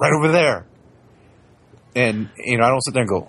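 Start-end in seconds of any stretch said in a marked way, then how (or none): 0.0-0.7 put-on higher, small kid voice pointing it out; a little kid insisting; childlike voice. Father quoting his child pointing, in her voice.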